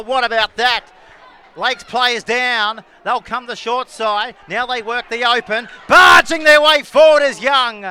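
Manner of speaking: punchy sports call building in excitement; lively play-by-play. Real, not acted. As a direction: call the attack as it unfolds, surging on big moments. Live play-by-play of attacking play.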